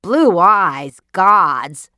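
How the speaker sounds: husband scoffing at his wife's dream, loud; cried out. Acted. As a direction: throw the words back at her in disbelief. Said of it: "cried Mister K".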